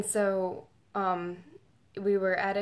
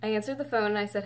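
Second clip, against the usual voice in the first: ordinary delivery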